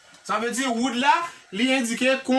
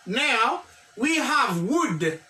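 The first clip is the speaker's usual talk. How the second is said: slow model reading; much slower than usual. Shown as a demonstration. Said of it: "teacher models English slowly".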